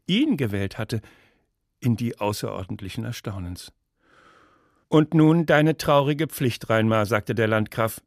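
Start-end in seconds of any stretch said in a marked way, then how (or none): 4.9-7.1 loud, slow, stately pronouncement; solemn ruler. Acted. Landgrave demanding the verdict from Reinmar, solemn and loud. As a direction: proclaim it slowly with a ruler's authority.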